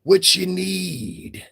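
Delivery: slow, drawn-out eager offer; mock eager caller. Mocking men who call her, slow eager offer. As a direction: stretch each word like a simp offering money. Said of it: "they going over calling this bitch".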